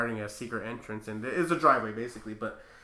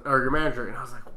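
ordinary delivery

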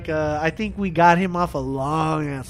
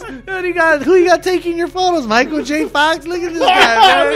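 mocking Big Show; 10 semitones higher, 4 dB louder than usual.

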